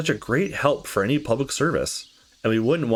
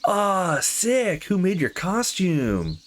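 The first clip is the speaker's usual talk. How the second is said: high, slow gushing fan voice; hammed-up wide-eyed admirer; mock impressed partygoer; 8 semitones higher, much slower than usual. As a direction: gush over the costume like an awestruck guest. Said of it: "Everyone would be like".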